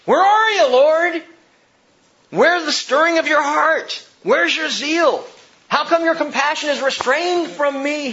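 high, loud impassioned cry to God; intense pleading. Acted. Preacher voicing the prophet crying to the Lord, intense pleading. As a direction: plead at full voice, aching for an answer. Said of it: "he pleads with this kind of intensity and pathos and passion".

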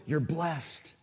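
normal talking voice

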